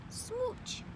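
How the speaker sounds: kiss. Acted. Kiss sound.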